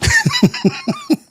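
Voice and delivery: high helpless burst of laughter; can't hold back the laugh; real laugh. Real, not acted. Streamer laughing at the clip despite himself. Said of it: "I shouldn't be laughing at that shit".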